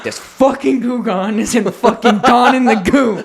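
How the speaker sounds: high, drawn-out ranting whine; exasperated comic rant; pissed-off rant. Retold or imitated. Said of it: "I'm just I'm ranting. I'm pissed".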